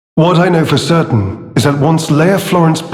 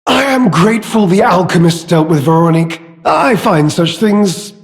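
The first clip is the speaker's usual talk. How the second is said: mock sincerity; 2 semitones higher than usual. Villain claiming to abhor violence, theatrically insincere. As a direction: feign noble distaste while savouring it. Villain feigns love of peace.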